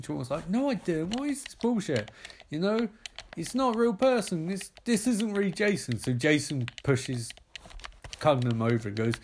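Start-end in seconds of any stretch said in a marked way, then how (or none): 0.5-6.0 high, flustered protest; panicked denial. Acted. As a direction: squeak out the excuse. Sean denies it in panic.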